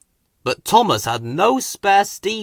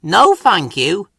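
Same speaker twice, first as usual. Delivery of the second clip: slow, louder, a proud little refusal; peeped refusal; 5 semitones higher, 6 dB louder than usual. A narrator's reading. Thomas refuses Hank's help, slow and proud. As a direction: turn down the help with stiff pride. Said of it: "peeped Thomas".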